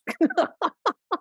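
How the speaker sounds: a high, light laugh at her own joke; playful laugh. Real, not acted. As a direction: laugh off the boast. Laughs after joking about authority.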